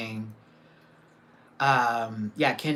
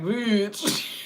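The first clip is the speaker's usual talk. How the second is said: laughing; 8 semitones higher than usual. Retold or imitated. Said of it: "but I was like".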